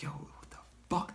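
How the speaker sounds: hushed disbelief; stunned whisper. Retold or imitated. Dreamer back in the room, shaken whisper. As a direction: whisper it to yourself in shock. Retold shock after the vision.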